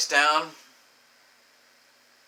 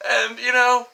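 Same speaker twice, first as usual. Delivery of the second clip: laughing; 10 semitones higher, 8 dB louder than usual. Real, not acted. Laughing cry.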